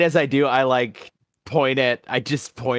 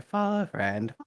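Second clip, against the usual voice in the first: softer, higher little mutter; small rat mutter; 5 semitones higher, 6 dB quieter than usual. Acted. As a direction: mumble it like a tiny rat. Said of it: "a small mutter".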